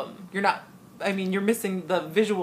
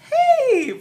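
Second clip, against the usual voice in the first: high, loud showbiz hey; bright mock-performer holler; cheery hey; 8 dB louder than usual. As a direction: call out a hammed-up greeting. Imitates choristers greeting her.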